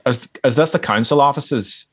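hammed-up Northern Irish; fake Irish accent. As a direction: put on a Northern Irish accent for a hoax call. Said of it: "like, a Northern Irish accent".

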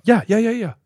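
high, quick brush-off yeses; mock breezy yes, yes; breezy reassurance. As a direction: toss off the yeses carelessly. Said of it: "I confirmed, like, four times".

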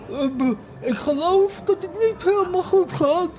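high, slow, weepy voice; tearful understatement. Retold or imitated. Sick friend tells the taxi driver things go wrong, weepy. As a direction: whimper it to the driver. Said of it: "En daar zegt mijn vriend naast me".

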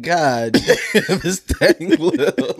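high, drawn-out laughing quote; stretched words giving way to laughter; mock mourner laughing. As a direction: crack up mid-imitation at the casket. Pretend mourner at the casket.

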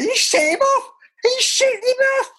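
very high, squealed mock yell; mock outraged shout. Spectator reacting to a ball boy being sent off, high mock yelling. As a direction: re-enact the outcry, voice leaping right up. Mimicking disbelief at a sending-off.

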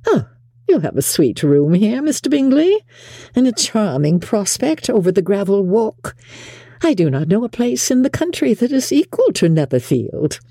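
high-pitched gushing social compliments; effusive, flattering mother. A narrator's reading. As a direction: flatter the host in a high, fussy voice. Voices Mrs Bennet flattering her host.

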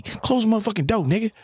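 high, rapid-fire boss tirade; acted angry rant. Boss rants at the guys smoking outside, angry. Acts out boss ranting at smokers.

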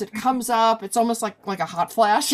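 usual speaking voice

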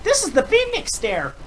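high, loud cartoon battle cry; silly clown. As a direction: shout it like a cartoon hero. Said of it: "I think of the little Care Bears".

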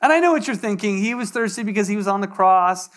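earnest sermon delivery with long pauses; preaching. Acted. Pastor confessing weakness to the congregation, earnest preaching. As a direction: confess it to the room, pausing.

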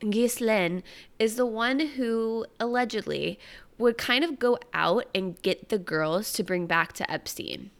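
ordinary talk, nothing marked

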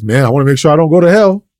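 mock fearful believer.

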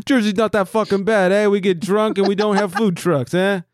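badly faked Jersey-meets-Boston accent; mock Jersey accent. Host plays a Jersey guy defending his state, mock accent. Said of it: "Sounds like our Boston accent".

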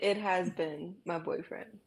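normal talking voice

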